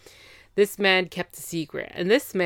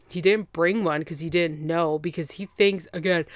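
usual speaking voice

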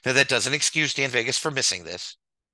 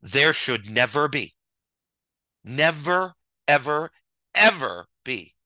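"never, ever, ever" hammered louder and louder; emphatic never ever; 2 semitones higher, 4 dB louder, slower than usual. Real, not acted. To the chat, stressing "never, ever, ever" rising in force. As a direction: punch each "never" and "ever" harder. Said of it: "I'm being very serious".

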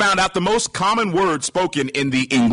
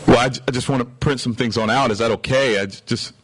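sheepish stammering; 7 semitones lower than usual. Retold or imitated. Retelling an awkward exchange.

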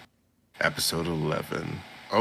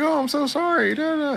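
squeaky, much higher apologetic receptionist; flustered apology; 3 dB louder than usual. Retold or imitated. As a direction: grovel in a tiny high voice. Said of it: "He's like".

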